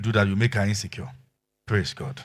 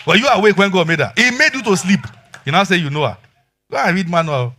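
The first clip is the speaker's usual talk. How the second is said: loud, high challenging preacher; fiery preaching; 5 semitones higher, 7 dB louder than usual. Acted. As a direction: hammer the point at the husbands. Preaching at full force.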